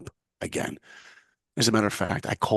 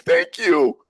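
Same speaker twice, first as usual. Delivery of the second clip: higher and louder, bursting with joy; overwhelmed thanks; 6 semitones higher, 8 dB louder than usual. Retold or imitated. Accepted intern thanking his friend on the phone, overjoyed. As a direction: blurt your thanks in disbelief.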